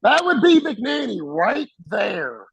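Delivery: high, stretched-out mock holler; imitated screaming. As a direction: holler it slowly like a furious chief. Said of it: "just screaming".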